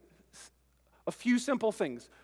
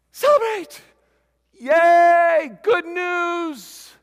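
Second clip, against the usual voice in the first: much higher and louder cheer; joyful celebration; 10 semitones higher, 13 dB louder, much slower than usual. Shown as a demonstration. Preacher cheering the victory news for the congregation, joyful. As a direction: cheer it out like a party. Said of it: "I can do what?".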